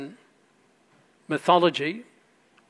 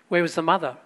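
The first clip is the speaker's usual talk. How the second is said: ordinary delivery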